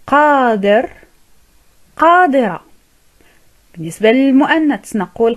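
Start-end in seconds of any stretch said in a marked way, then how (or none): none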